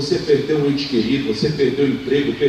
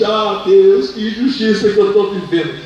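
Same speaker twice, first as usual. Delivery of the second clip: lamenting; 5 semitones higher, 3 dB louder than usual. Acted. Hagar complaining to God.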